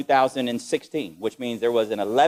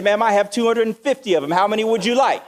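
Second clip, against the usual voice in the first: proud offer; 7 semitones higher, 4 dB louder than usual. Retold or imitated. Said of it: "I said".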